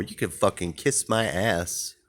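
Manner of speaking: ordinary talk, nothing marked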